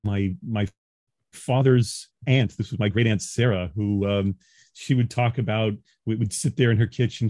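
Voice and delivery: ordinary talk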